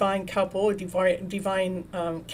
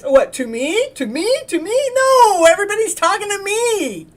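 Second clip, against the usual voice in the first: high, loud mock outrage climbing higher each time; mock indignant; 10 semitones higher, 8 dB louder than usual. Imitating Gregory and Kathleen, climbing mock indignation. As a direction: ham up a diva who thinks it's all about her. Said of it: "sometimes they're like".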